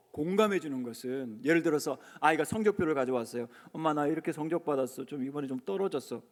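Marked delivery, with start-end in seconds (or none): 3.7-6.2 a child's small, softer voice, owning up to bad grades; child sheepishly showing a poor report card to mum; timid child. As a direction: mumble it like a kid confessing.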